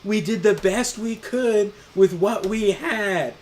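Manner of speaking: loud, high, drawn-out relay; loud repeating. Retold or imitated. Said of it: "I stepped in and I was like".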